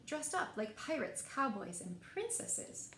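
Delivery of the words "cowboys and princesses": usual speaking voice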